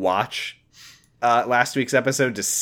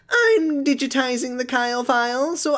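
high, put-on goofy character voice; hammed-up high voice of an annoying kid; [name]; 11 semitones higher than usual. [name] explains his filming to his mother, mock goofy voice.

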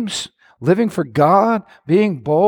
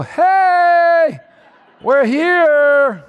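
calling out; 10 semitones higher, much slower than usual. Acted. Preacher acts Jonathan calling out.

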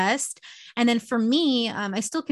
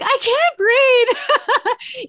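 much higher, louder, very slow and stretched out; exaggerated desperate plea; 9 dB louder, much slower than usual. Answering mask nagging with a hammed-up desperate plea. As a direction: ham up gasping for air. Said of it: "And I'm like".

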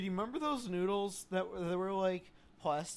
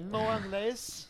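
slow, mock Spanish "No inglés"; fake Spanish speaker; 4 semitones higher, 3 dB louder, much slower than usual. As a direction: play dumb in a Spanish accent. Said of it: "fake non-English speaker act".

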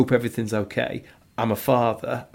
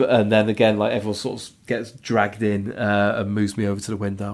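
usual speaking voice

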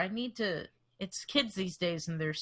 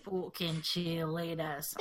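slow, put-on British swearing; savored British accent, dropped R in "cheerleaders"; 2 semitones lower, 3 dB quieter, slower than usual. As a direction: drawl it out in a mock British accent. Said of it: "just talk in a British accent".

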